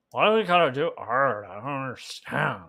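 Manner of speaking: mock lament in a goofy character voice; silly distorted voice, playfully bewildered; funny put-on voice. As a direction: whine comically like an odd college guy. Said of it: "when I did that voice".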